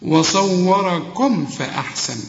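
وصوركم فأحسن slow and drawn out; slow careful recitation. Shown as a demonstration. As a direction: recite وصوركم فأحسن slowly, فأحسن kept light. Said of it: "فأحسن كلها مرققة".

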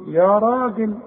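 ordinary delivery

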